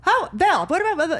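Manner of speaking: high, loud mocking chatter; mimicking chatty mom. Daughter mimics mom chattering at dad. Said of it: "Mom and I are about".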